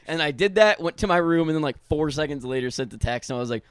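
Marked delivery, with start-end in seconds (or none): none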